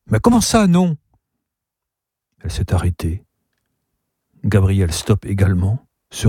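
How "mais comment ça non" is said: put-on high, protesting child's voice; taken aback. A narrator's reading. Niece refused the métro by her uncle, taken aback. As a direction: question the refusal in a high pitch.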